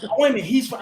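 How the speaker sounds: high-pitched imitation of a surprised friend; mock surprise, pitched well up; retold surprise. Retelling friends' reaction to the actor being British, surprised.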